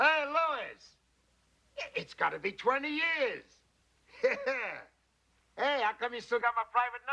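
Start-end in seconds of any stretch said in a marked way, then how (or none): 0.0-3.4 high-pitched, hearty hello; effusive greeting. Acted. Studio man greets Louis after twenty years, effusive. Greets long-lost acquaintance by phone. 4.2-4.8 high-pitched social chuckle; short laugh of greeting; hearty laugh. Acted. Studio man greets an old acquaintance, hearty chuckle.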